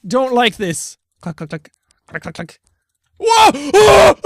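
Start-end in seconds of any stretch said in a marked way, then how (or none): 1.2-2.6 deep quiet clicks; low imitated clicking.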